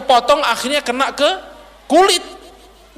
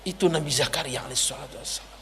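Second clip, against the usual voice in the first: soft, low and quick; hushed reverence; 9 semitones lower, 12 dB quieter, faster than usual. Real, not acted. Preacher ends the prophet's story in a soft, low voice. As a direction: close the story in a reverent hush.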